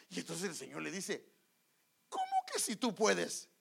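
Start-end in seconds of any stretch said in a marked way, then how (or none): 2.1-3.5 loud, higher challenging question; incredulous challenge. Acted. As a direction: throw the doubt back at him, louder. El Señor cuestiona la duda. (reto incrédulo en voz alta)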